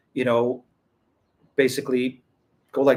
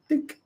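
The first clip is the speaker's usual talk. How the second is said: high squeaky dink for a button click; playful dink; 3 dB quieter than usual. Retold or imitated. Joking about one-click translation, high squeaky dink. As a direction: pop out a high little click sound.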